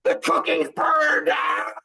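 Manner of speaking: dad mimicking his family panicking over burnt cookies, shrill mock crying; mock hysterical wife and daughters. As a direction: parody them melting down over burnt cookies. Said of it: "they're like".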